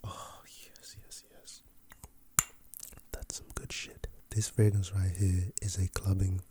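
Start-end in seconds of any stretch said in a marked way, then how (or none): 0.0-4.1 whispering. Real, not acted. Savoring the scent after spraying.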